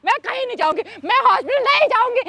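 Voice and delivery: high, pleading insistence; wife desperately refusing to go to the hospital; desperate refusal. Acted. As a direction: dig in and refuse again and again.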